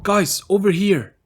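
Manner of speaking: high, loud, slow call to the group; excited call. Acted. William calling his friends over to a door, loud and high. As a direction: shout them over, wide-eyed.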